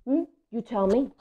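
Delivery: plain reading voice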